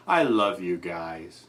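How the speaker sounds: tender. Real, not acted. Caller softens toward the hosts.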